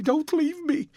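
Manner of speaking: last pleading cry before the sobbing; rising to a sob. A narrator's reading. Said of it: "A fit of passionate sobbing".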